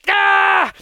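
short loud, high "ah"; hammed-up exasperated cry; frustrated yell. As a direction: burst out in mock frustration. Imitating frustration of stuck argument.

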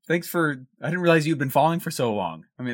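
ordinary talk

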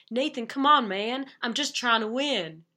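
mock the coach's sudden Southern twang; heavy Southern accent. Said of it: "I'm gonna try to impersonate it".

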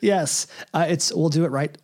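normal talking voice

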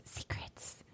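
breathy spy whisper. Retold or imitated. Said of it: "in this whisper voice".

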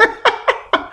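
high, breathy burst of giggles; high giggling laugh. Real, not acted. Podcaster rushing mustard to the fridge, giggling laugh. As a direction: giggle at your own mistake.

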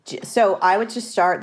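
ordinary talk, nothing marked

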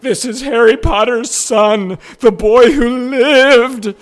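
high, loud, slow starstruck awe; mock-awestruck squeal; starstruck inner gasp. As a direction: gush like a stunned fan. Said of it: "I'm like".

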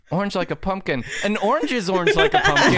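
high-pitched mocking imitation; Julia. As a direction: mimic her, speeding up into laughter. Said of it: "Julia, Julia went".

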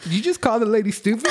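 high, incredulous question; can't believe she said it; mock-stunned coworker; shocked disbelief. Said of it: "I was like".

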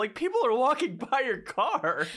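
mock yelling at the creep; incredulous scolding. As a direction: squeal at him in disbelief. Berating Cameron, laughing.

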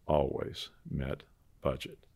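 ordinary talk, nothing marked

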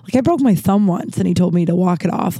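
usual speaking voice

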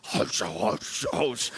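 imitated swishing strides through brush; hushed, rushing mouth-made footsteps; footstep noises. Imitating Phil striding through woods.

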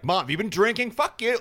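put-on high, laughing teen retort; hammed-up cheeky defiance; mock bratty comeback. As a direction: throw the question back at mom with a laugh. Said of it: "I'd be like".

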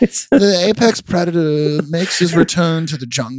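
slow, grand documentary narrator voice; nature documentary. Retold or imitated. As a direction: announce it like a wildlife film.

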